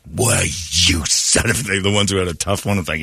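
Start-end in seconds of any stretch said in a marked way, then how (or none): 0.0-1.8 mock resentful grumble. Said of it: "the rest of them just".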